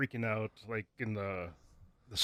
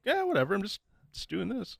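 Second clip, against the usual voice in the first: much higher-pitched mock brush-off; imitated nonchalant girl; 10 semitones higher, 3 dB quieter than usual. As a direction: put on a high voice, tailing off softer. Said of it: "she's so like".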